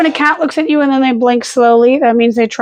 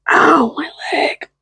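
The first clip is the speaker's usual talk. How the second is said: pained; 3 semitones higher, much slower than usual. Real, not acted. Dog steps on leg.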